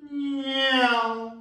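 high, loud, sliding car-passing noise; car whoosh. Shown as a demonstration. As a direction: imitate a car zooming past, high and loud. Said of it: "Could we do a car coming down the road?".

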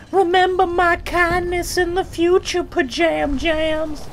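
very high put-on voice; mock taunting character. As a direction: tease pajama boy in a squeaky high voice.

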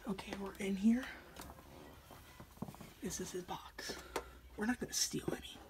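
hushed secret whisper. Real, not acted. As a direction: whisper so he can't overhear. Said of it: "Yes, I'm whispering 'cause he can probably hear".

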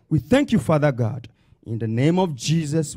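ordinary delivery

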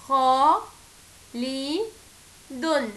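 خالد broken as خا، لي، دن with a pause after each; syllable-by-syllable teaching demo; split into syllables. Shown as a demonstration. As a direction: tap out خا لي دن one by one. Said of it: "فيها كام صوت؟".

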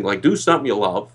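ordinary talk, nothing marked

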